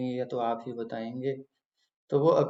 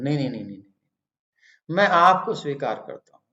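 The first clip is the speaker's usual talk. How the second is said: insistent protest; 6 dB louder, faster than usual. Retold or imitated. Devotee refuses to let the Lord leave, insistent protest. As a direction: protest quickly, then insist more loudly.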